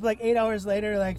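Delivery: ordinary delivery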